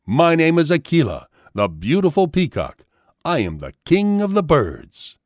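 lightly voiced bird king declaring himself; proud peacock. A narrator's reading. Peacock's closing self-introduction.

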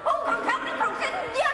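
shrill mock wail. Dubbing catfight.

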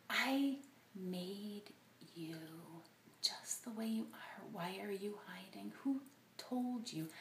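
soft, breathless whisper; whispered tender God. Retold or imitated. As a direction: whisper it lovingly. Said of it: "what if instead he was saying".

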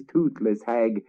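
ordinary delivery, nothing marked

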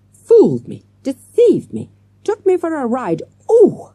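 loud, rising, high fury; teacher raging at those who tricked her; furious outburst. A narrator's reading. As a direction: build to a wail of rage.

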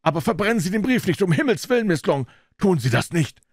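high, frantic begging; panicked, high-pitched pleading; desperate cry. A narrator's reading. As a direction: implore with the pitch far up. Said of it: "schrie Jennings".

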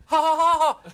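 flat mocking ha ha ha, loud; sarcastic fake laugh. Mock laugh at prank.

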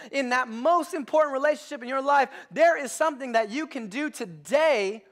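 loud, high-pitched preaching push; full-force preaching. Acted. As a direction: preach it hard to the congregation.

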